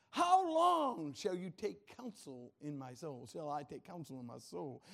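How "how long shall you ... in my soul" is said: slow, emphatic cry on "How long"; David crying out. Acted. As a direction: proclaim the psalm as an anguished plea. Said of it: "Can you imagine David saying that".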